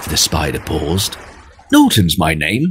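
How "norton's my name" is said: usual speaking voice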